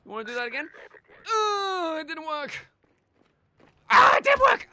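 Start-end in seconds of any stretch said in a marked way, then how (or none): 1.2-2.7 high wail, easing off in volume; dismayed whine. Pretend. Player whose attack failed, high dismayed whine. 3.9-4.7 very high, very loud scream; screamed alarm. Pretend. Player finding the attack worked after all, panicked scream.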